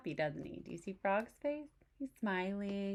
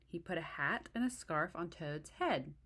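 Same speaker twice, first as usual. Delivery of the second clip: ordinary delivery, nothing marked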